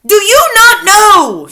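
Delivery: slow, loud, high witch shout; shouting witch leader. A narrator's reading. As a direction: scold the crowd as the witch leader. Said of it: "she shouted at them".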